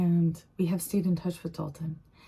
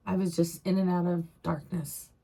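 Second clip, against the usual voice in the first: normal talking voice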